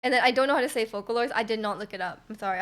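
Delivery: ordinary talk, nothing marked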